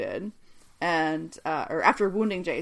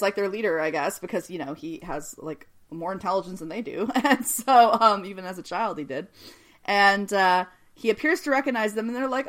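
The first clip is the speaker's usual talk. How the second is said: ordinary talk, nothing marked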